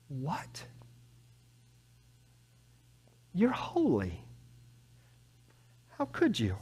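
soft, hushed disbelief; bewildered whisper. Acted. Habakkuk to God, hushed and bewildered. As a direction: breathe it out, stunned.

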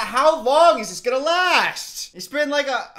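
loud, high, drawn-out complaint; exasperated shout. Real, not acted. Viewer suffering through a long scene, exasperated shouting. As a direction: wail it out at the top of your voice. Fed up with the film.

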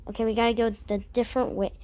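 ordinary talk, nothing marked

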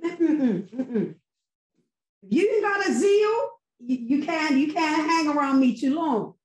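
usual speaking voice

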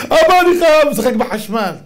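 put-on a child demanding a toy gun; whiny child. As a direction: beg like a spoiled kid. Child demanding to play electricity.

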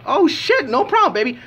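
high, excited mock reply to the wife; eager self. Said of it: "And I'm like".

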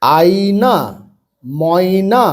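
আয়না, ময়না stretched syllable by syllable; slow, broken reading. Shown as a demonstration. Teacher showing beginners the first step, read slowly. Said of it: "তাহলে এবার তাড়াতাড়ি পড়বে".

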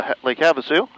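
ordinary delivery

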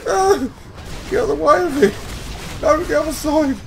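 high, loud calls to get clear; urgent shouting. Real, not acted. Raider warning teammates about the lava, high loud shouting. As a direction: yell the group out of danger.

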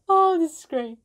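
high, loud praise; loving the recording, high and loud; delighted. Real, not acted. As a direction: gush about it. Delighted reaction.

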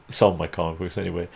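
ordinary talk

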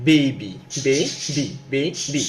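"বেবি" slowly split as বে, বি; broken into syllables. Shown as a demonstration. Teacher showing how to read by breaking words up, syllable by syllable. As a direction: say "বেবি" in two drawn-out beats. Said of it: "এভাবে ভেঙে ভেঙে পড়ি".

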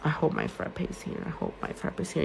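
unvoiced hopeful whisper, said twice; whispered wish. Real, not acted. Muttering a wish to herself.

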